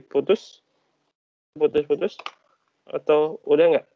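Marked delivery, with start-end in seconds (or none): none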